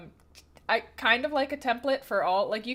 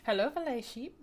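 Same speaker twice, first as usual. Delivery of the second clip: ordinary delivery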